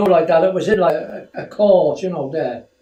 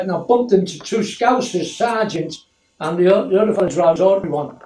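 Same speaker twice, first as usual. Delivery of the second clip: ordinary talk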